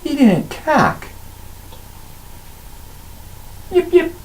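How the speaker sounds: high, slow kobold voice talking itself up; a tiny creature reassuring the pack; bluffing kobold. Acted.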